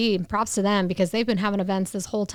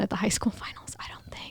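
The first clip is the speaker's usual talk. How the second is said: whispered aside; conspiratorial whisper; 11 dB quieter than usual. Real, not acted. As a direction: drop to a whisper, half joking. Confiding aside about accent plan.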